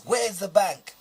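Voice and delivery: ordinary talk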